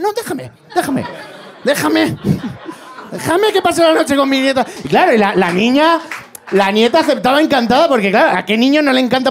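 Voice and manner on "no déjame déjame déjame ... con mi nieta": high, laughing pleading voice; mock Gandhi pleading. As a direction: beg in a squeaky mock Gandhi voice. (Gandhi suplicando con voz aguda y burlona)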